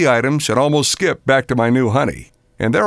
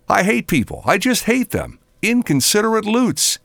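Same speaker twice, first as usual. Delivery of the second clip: higher-pitched, mildly acted exasperation; indignant rant; 7 semitones higher than usual. A narrator's reading. Narrator voices his own angry rant.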